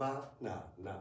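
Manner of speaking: Ba-na-na with equal-length syllables; even syllables. Shown as a demonstration. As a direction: keep each syllable of Ba-na-na the same length. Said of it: "same length of time".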